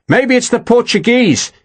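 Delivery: high-pitched call to a comrade; shouting back. A narrator's reading. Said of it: "he shouted back to Harper".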